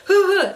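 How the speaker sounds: high, loud laughing hoots like a gangster bursting in; excited whoop. Retold or imitated. Douglas bursting in with the gun, whooping and laughing. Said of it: "was like".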